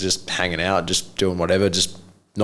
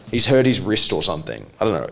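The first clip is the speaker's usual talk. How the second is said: usual speaking voice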